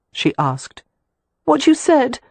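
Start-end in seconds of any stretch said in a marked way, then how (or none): 1.4-2.2 Catherine, hurt. A narrator's reading. To Leo, whom she overheard, a high hurt accusation.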